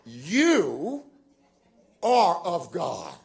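"You" high and drawn out, "are of God" slow; heavily stressed words. Shown as a demonstration. Preacher showing how he used to read scripture, "You are" stressed. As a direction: stretch and punch "You", then "are". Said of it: "this is how I would do it".

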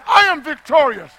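high, loud triumphant proclamation; forceful victor's shout; God declaring victory. Acted. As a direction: declare it like planting a spear. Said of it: "That's God putting his spear in the ground and saying".

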